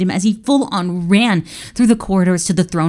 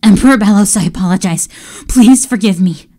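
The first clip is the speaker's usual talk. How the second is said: pleading; 2 semitones higher, 7 dB louder than usual. Acted. Servant begging forgiveness.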